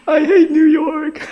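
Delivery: high, loud, slow comic wail; hammed-up miserable bawling; mock sobbing. Miserable teen complaining to God in his room, mock sobbing. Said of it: "pretty miserable, sobbing".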